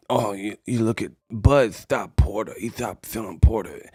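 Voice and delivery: low, soft mumbling imitation of Tyson; Mike Tyson impression. Comedian mocks Mike Tyson's boxing picks, low soft impression.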